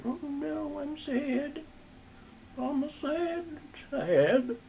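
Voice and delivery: high, soft mock-sad little voice; hammed-up sad voice of the drawn girl. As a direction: whimper it like a cartoon girl pretending to be sad.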